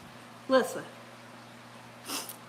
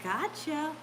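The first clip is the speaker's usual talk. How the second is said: teasing ride lady; 6 dB quieter than usual. Retold or imitated. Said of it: "she was like".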